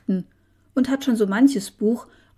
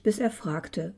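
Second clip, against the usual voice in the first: ordinary delivery, nothing marked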